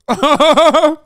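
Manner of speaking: loud, high burst of laughter. Real, not acted. Laughs at streamer's joke.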